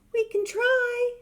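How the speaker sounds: very high, slow little ant; tiny ant voice. Acted. Ant reassuring the elephant, high tiny voice. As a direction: squeak it bravely. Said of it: "said the ant".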